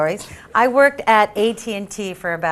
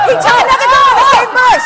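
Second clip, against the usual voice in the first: high, loud exasperated punchline; loud, high indignant payoff; indignant outburst; 12 dB louder than usual. Real, not acted. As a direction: blurt the betrayal out. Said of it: "I just had to get it out".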